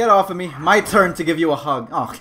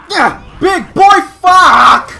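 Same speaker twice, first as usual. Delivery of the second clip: high, loud gamer shout; battle yell; 12 semitones higher, 13 dB louder, much slower than usual. Real, not acted. Gamer landing hits in a fight, shouting high.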